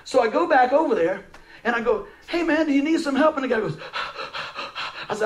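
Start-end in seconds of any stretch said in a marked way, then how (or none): 2.3-3.4 raised, high-pitched call. Retold or imitated. Preacher retelling his offer to a stranded driver, calling out. 3.8-5.0 imitated breathless huffing; puffing and blowing. Imitating a man pushing his car, puffing and blowing. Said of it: "he was puffing and blowing".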